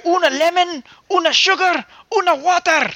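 put-on high, loud botched German accent; a German accent drifting Scottish; mock German accent. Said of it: "Why do I have a Scottish accent while trying to talk German?".

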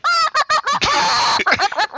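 high wheezing laugh. Real, not acted. Laughs at bird noise.

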